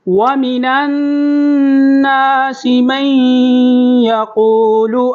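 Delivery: slow, high melodic recitation of ختم اللہ علی قلوبہم; model recitation. Shown as a demonstration. Teacher recites so students can listen carefully, slow melodic chant. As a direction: chant it slowly for students to copy. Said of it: "میرے ساتھ آپ بھی دہرائیں".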